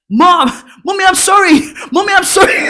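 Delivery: put-on high, loud child's plea; pleading shout. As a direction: beg your mom at full voice. Child begging mom while fleeing.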